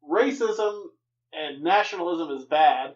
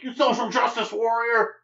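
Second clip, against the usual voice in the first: high, loud mock outrage; yelling listener; 5 semitones higher, 4 dB louder than usual. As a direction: yell like an indignant heckler. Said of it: "someone's yelling at me".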